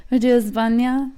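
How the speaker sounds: put-on España with a sultry Spanish accent. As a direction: roll it out in a playful Spanish accent. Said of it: "your sexy Spanish accent".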